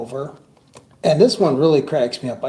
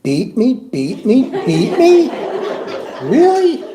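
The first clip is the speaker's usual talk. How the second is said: rising from confused to high incredulous Really?; puzzled disbelief; 8 semitones higher, 5 dB louder, slower than usual. Retold or imitated. Squinting at the breathe sign, misreading it, rising disbelief. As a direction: read the sign wrong, building to a high disbelieving squeak. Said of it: "and I said".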